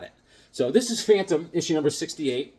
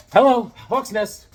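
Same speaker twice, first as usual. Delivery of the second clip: answering the phone; 7 semitones higher, 7 dB louder, slower than usual. Acted.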